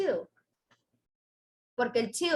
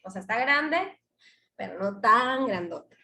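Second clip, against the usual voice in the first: normal talking voice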